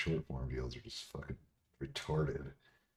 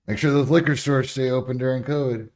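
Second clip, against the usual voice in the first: sarcastic; 8 semitones higher, 9 dB louder than usual. Real, not acted. Sarcastic rant about alcohol.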